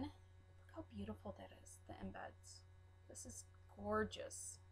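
awed whisper. Real, not acted. Admiring wax melt embeds.

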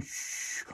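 quiet unvoiced shh; soft shushing. Shown as a demonstration. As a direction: gently hush it away. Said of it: "say it again".

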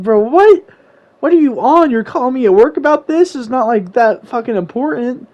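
high-pitched, indignant disbelief; incredulous outburst. Retold or imitated. Boyfriend objecting to being called at work, incredulous. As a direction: protest in rising, exasperated disbelief. Retold angry phone argument.

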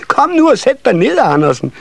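put-on high, impatient child cry; shrieking children. A narrator's reading. Children urging Andersen to sit, high shrieking. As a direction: squeal it out like eager youngsters. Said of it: "skreg ungerne".

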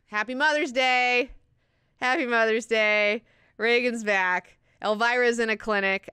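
exuberant, hammed-up announcing; mock celebration. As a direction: proclaim the good news like party greetings. Mock celebration of happy ending.

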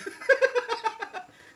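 loud squealing laugh; high shrieking laugh. Real, not acted. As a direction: burst into a shrill laugh. Big laugh at teasing.

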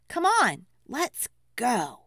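plain reading voice

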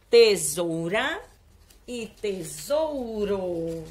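very slow, stretched syllables; slow, deliberate word reveal; drawn-out reading. Shown as a demonstration. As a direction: let each word hang for the class to join in. Teacher reveals riddle answers slowly.

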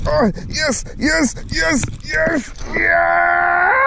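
high repeated cries rising to a shout; real, overwhelmed elation; jubilant. Real, not acted. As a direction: voice soaring in triumph. Landing the fish.

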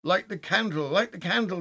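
high, loud, frantic plea; voice breaking. A narrator's reading. Frightened man begs his friend to light the candle, voice breaking. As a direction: cry it out urgently, pitch jumping up. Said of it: "his voice broke, as a boy's does".

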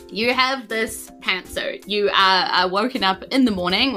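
usual speaking voice

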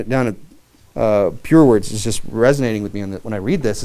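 ordinary talk

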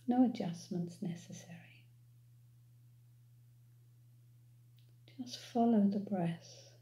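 calm, unhurried meditation voice with long pauses; guided meditation. Real, not acted. Guide leading listeners to follow their breath, calm meditation voice. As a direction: ease them gently into noticing the breath.

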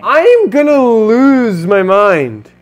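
high, loud, slow wail; frantic despair. Real, not acted. Player despairs as the level overwhelms. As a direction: stretch it out in despair.

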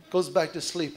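usual speaking voice

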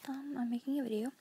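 hushed whisper all the way through; whispered vlog to the camera. Real, not acted. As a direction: keep it to a whisper, like someone might hear. Kid whispers whole vlog.

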